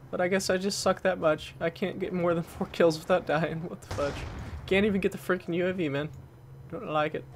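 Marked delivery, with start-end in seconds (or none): none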